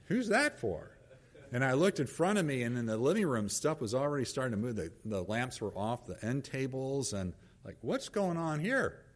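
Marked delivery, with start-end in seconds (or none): 0.0-1.0 higher, pointed accusing question; hammed-up suspicion, pitched way up; suspicious tone. Husband at the door questioning wife's soup, mock-accusing. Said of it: "A tone". 7.8-9.0 higher, pointed demand; a hint of edgy accusation; accusing tone. Husband seeing the lamps moved, mock-accusing. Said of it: "Another tone to it".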